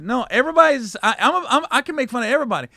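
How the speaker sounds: insist, voice climbing; insistent. Retold or imitated. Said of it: "I said".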